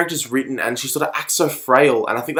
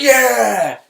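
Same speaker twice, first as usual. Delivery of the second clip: crowd cheer; 9 semitones higher, 3 dB louder than usual. Retold or imitated. Crowd cheering a big speech.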